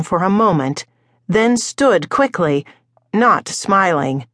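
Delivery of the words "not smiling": plain reading voice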